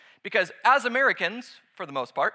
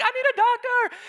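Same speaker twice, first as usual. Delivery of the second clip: much higher, louder, desperate mock cry; melodramatic plea; 6 dB louder than usual. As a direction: wail for a doctor like a ham actor. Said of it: "You know, I'm like".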